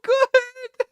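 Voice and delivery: very high, drawn-out loud scream; screeching cry of defeat; anguished wail. Real, not acted. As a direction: wail it in despair, stretching every word. Anguished outburst at losing the run.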